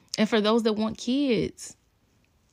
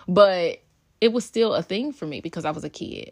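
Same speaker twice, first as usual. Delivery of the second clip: ordinary talk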